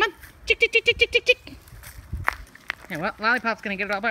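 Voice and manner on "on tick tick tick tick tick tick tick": high, loud ticking call; calling chickens. Real, not acted.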